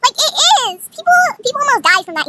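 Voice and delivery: high, loud, rushing argument; insistent retort. Retold or imitated. Acted insistent comeback.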